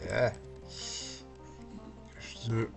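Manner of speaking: ordinary talk, nothing marked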